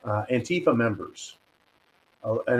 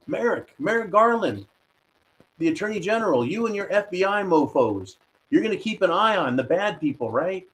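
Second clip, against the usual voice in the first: mocking address; 6 semitones higher than usual. Mock address to Attorney General.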